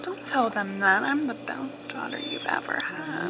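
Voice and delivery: playful whispered comeback. Real, not acted. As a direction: whisper it teasingly. Whispered retort to mom.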